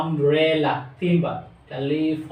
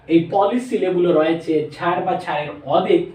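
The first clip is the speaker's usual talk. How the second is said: ordinary talk, nothing marked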